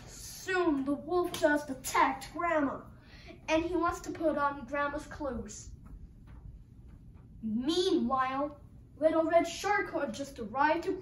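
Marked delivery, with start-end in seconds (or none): none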